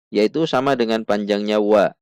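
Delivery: usual speaking voice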